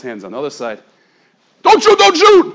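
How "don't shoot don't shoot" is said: panicked surrender shout. Retold or imitated. Men raise hands to police.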